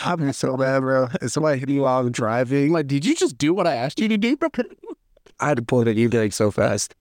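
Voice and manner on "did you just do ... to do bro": high, disbelieving question; mock-outraged disbelief, pitched up; incredulous. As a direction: ask it like you can't believe it.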